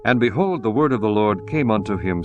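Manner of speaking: ordinary delivery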